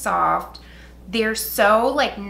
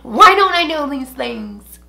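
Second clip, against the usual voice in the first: loud, raised-pitch self-scolding; retold exasperation; 4 semitones higher, 8 dB louder than usual. Vlogger scolding herself over a duplicate, exasperated. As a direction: wail at your own forgetfulness, half in jest. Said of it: "I was so pissed".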